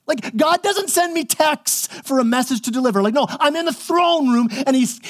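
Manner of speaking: high, offended, bro-ish angel; hammed-up offended angel; indignant Gabriel. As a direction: do you know who I am, indignantly. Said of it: "Gabe's like".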